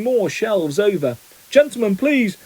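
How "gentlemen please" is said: high, pleading cry. A narrator's reading. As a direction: plead high, climbing on the last word. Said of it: "cried the assistant".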